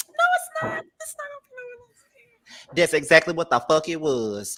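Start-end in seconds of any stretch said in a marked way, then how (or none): 0.1-2.8 much higher, mocking sing-song denial; mock the fake promise in a squeaky voice. Mocking her own reassurance.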